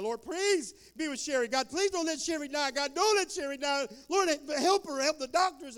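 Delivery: high, loud pleading to God; desperate prayer. Retold or imitated. Youth group begs God to save Sherry, high and loud. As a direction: kids crying out for a dying friend. Kids praying over Sherry.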